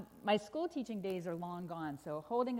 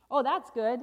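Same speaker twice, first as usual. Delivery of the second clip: loud burst of approval; emphatic delight; 3 semitones higher, 11 dB louder than usual. Acted. Storyteller reacts to elephant rescue, loud delight. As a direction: cheer the good news.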